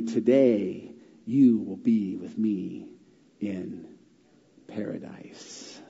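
slow, softening to breath on paradise; hushed reverent whisper; fading to a whisper. Acted. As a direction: let the promise trail off tenderly. Reading Jesus' promise reverently.

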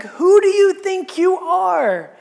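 loud, high, drawn-out indignant challenge; retold fury. Furious rulers end their argument with Jesus, loud retold indignation. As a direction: imitate the outraged rulers, voice pushed right up. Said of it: "and they are furious".

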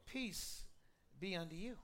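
soft, low, unhurried blessing; hushed, calm greeting; gentle Jesus. Acted. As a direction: drop right down and offer it quietly. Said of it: "He just says to them".

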